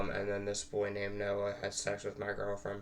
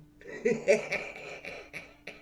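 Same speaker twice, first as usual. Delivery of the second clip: breathy laugh; 12 semitones higher than usual. Real, not acted. Laughs after the impression.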